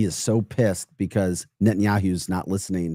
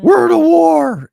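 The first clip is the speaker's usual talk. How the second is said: shrill, much higher, much louder mock outcry, drawn out slowly; mock battle cry; 11 dB louder, slower than usual. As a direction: parody a hysterical protester yelling. Mocking Democrats breaking into camps.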